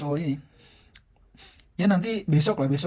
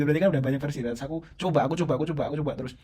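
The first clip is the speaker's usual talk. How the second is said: ordinary delivery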